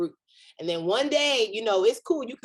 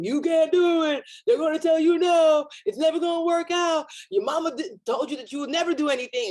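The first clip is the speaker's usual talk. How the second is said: voice of fear; 7 semitones higher than usual. Retold or imitated. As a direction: play the doubting voice on the mic, jeering. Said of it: "it's a man on the microphone".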